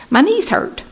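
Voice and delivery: mock whine about sore knees; whiny complaint. Teacher telling the doctor her knees hurt, mock whiny and high.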